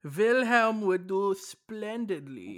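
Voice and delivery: slow, hammed-up German accent; a pompous German gentleman, drawn out. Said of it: "doing that German accent".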